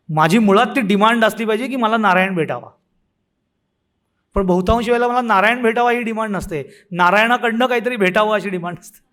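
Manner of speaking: usual speaking voice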